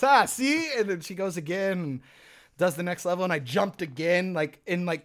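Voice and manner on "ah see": high, loud mocking; teasing gotcha. Hannah teasing him after he jumps, high loud mocking. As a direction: rub it in, told-you-so.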